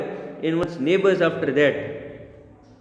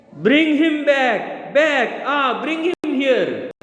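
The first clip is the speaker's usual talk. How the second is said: high, slow hammed-up wail; 6 semitones higher, much slower than usual. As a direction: stretch it out like a stage lament. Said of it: "fallen into easy chair and wails".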